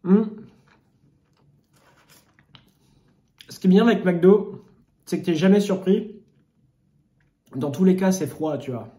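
eating a burger between words; chewing while talking. Real, not acted. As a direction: mouth partly full of food. Seen 0: Bites burger at -5, chews then talks with burger in hand.

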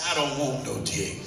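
imitating him, low and flat; low mock man voice. Woman mimicking her date turning her down, low and dry. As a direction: drop the voice low and gruff for the boyfriend.

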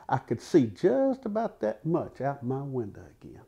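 ordinary delivery, nothing marked

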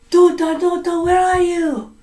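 high, loud calling. Acted. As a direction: call for the lost daughter. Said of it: "she says it again".

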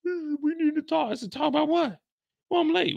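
high-pitched mock voice for a retold exchange; mocking imitation. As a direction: mimic her in a squeaky high register. Mockingly replays her conversation with him.

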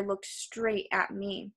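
plain reading voice

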